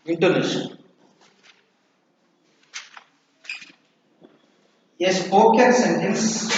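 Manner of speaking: slow, measured classroom dictation. Real, not acted. Teacher dictating intonation notes for students to write, slow dictation. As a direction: dictate in short repeated chunks with long pauses.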